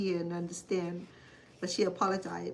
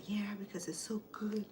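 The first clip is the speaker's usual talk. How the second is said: whispered admission; 3 semitones higher, 6 dB quieter than usual. Retold or imitated. Sister admitting she loves the dessert, whispered. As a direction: whisper it like a confession. Sister's quoted reply voiced.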